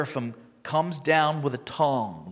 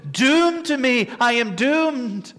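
despairing cry; 6 semitones higher, slower than usual. Retold or imitated. Isaiah's cry, acted in sermon.

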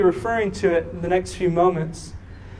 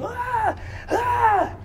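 imitated child's wail; 4 dB quieter than usual. Father imitating daughter sitting there screaming, high held-back shrieks. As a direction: wail high like a little girl. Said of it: "she's just sitting there going".

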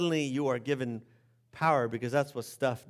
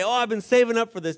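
greedy guest; 7 semitones higher, 7 dB louder, faster than usual. Retold or imitated. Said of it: "And people say".

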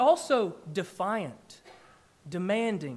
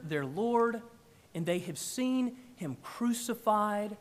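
ordinary talk, nothing marked

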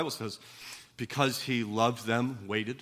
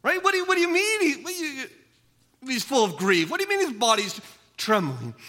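high, incredulous voice of a skeptic in the pews; doubting listener; 12 semitones higher, 6 dB louder, faster than usual. Pretend. Preacher voicing a listener who objects, high and incredulous. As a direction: challenge the preacher, half-mocking disbelief. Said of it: "Now, you may say".

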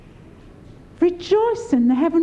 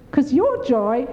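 ordinary delivery

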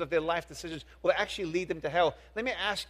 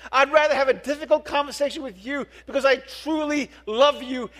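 raised, driving pulpit voice; fervent sermon climax; preaching at full force; 6 semitones higher, 6 dB louder than usual. Acted. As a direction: press the congregation hard, building intensity.

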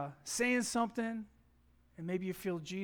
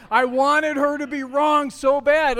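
much louder, higher, slow and stretched; exaggerated frustration; 7 semitones higher, 15 dB louder, slower than usual. Real, not acted. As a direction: wail it out in mock despair.

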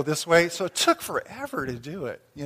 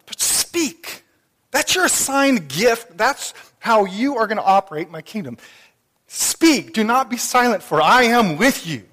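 loud, ringing preacher's voice, slowing on key words; preaching at full force; 4 semitones higher, 8 dB louder than usual. Acted. The Lord urging Paul, preached at full force. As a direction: thunder the Lord's command to speak out.

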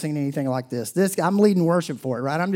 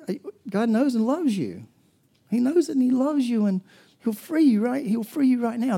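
voicing the addict, tender and high; 8 semitones higher than usual. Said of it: "he says".

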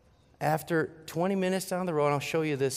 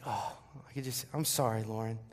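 guilty apology; 4 semitones lower, 6 dB quieter than usual. Retold or imitated. Retells guilty apology to crying daughter.

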